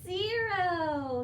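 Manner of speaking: ordinary talk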